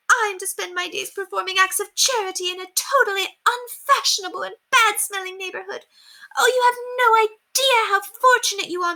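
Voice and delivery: high, weepy melodramatic wailing; self-pitying mistress crying in the maid's arms; distraught. Acted. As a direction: sob through the self-pity.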